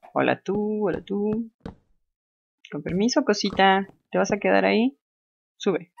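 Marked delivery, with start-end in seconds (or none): 0.1-1.5 sweet sing-song hello to a little creature; cute greeting. Real, not acted. Player greeting a cute mob in a sweet coo. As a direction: coo at the animal like a pet.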